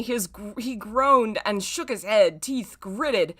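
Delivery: ordinary talk, nothing marked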